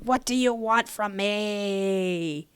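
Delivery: ordinary delivery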